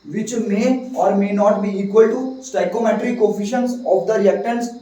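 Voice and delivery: slow dictation pace; slowly, word by word for notes; dictating. Shown as a demonstration. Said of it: "इस बात का मतलब किस किस को समझ आया".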